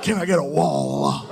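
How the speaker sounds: mock gospel preacher; preacher call. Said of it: "He's just like".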